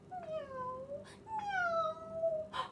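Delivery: long high mewing meow; cat meow. Acted. Reader mimics Mog meowing in the rain. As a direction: mew like a small wet cat.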